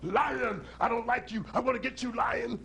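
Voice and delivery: lightly acted angry elephant; mildly menacing elephant. Elephant threatens the lion.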